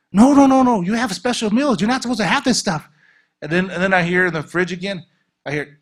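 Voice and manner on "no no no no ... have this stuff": loud, high no-no-no; alarmed scolding. Retold or imitated. Scolding the girl caught eating cake, loud and high. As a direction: stop her eating it, firm and loud. Scolding woman over forbidden food.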